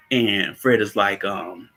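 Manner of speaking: ordinary talk, nothing marked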